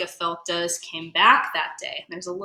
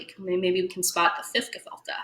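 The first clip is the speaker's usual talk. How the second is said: ordinary delivery, nothing marked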